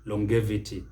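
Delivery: Longevity with a hard g, long-GEV-ity; mispronunciation. Shown as a demonstration. Teacher echoes many people's mistake, mispronounced longevity. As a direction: say Longevity as long plus gevity. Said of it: "what you hear with many people".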